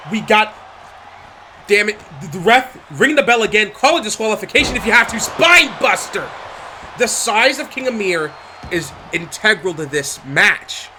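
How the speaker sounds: ordinary talk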